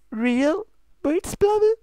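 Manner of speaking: high, drawn-out, incredulous; slow disgusted question. Acted. Luna holding the smelly cupcake, slow and disbelieving. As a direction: stretch out the words in disbelief.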